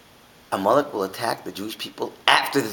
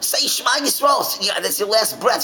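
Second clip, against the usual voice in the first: higher, urgent soldier's urging; urgent plea; 10 semitones higher than usual. Retold or imitated. Soldier urging his dying friend, higher and urgent. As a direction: push him to pray, fast. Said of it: "says, tells him".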